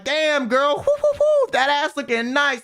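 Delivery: loud, high hooting catcall with a laugh; mock catcaller. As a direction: ham up a crude street catcaller whooping. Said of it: "I'm not talking about catcalling".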